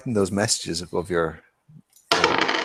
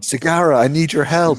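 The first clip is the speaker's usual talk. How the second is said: higher-pitched, playful appeal; mock plea for help; 7 semitones higher than usual. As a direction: call on a comrade in mock need. Acted plea to fellow character.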